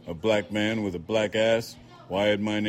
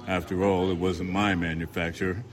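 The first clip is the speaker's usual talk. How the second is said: usual speaking voice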